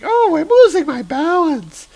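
very high dizzy villainess wail; Vertigo losing balance. Acted. Vertigo caught in Thor's vortex, very high dizzy squeal. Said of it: "And she's like".

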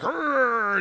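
Odin's cartoon roar; mock blast yell. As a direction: yell like unleashing energy. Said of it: "Odin's like".

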